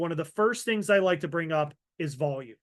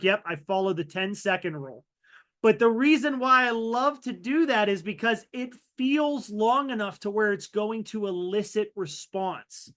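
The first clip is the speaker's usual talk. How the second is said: usual speaking voice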